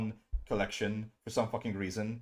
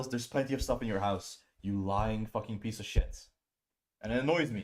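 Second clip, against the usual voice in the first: usual speaking voice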